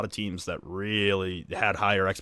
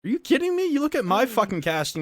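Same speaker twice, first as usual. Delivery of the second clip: very high, emphatic agreement; worked-up, high-pitched exclamation; incredulous outburst; 13 semitones higher, 5 dB louder than usual. Real, not acted. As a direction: burst out in disbelief.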